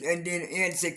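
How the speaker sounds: normal talking voice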